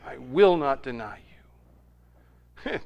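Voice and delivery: slow, intense whisper; whispered vow. Retold or imitated. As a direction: promise it under the breath, word by word. Peter's vow drops to intensity.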